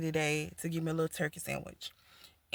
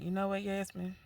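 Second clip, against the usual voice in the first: quiet self-talk; 5 dB quieter than usual. Retold or imitated. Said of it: "And I said".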